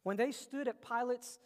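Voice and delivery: loud, rising preacher's build; preaching at full force. Acted. Preacher to the congregation, building to a loud climax. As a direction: drive it home to the congregation.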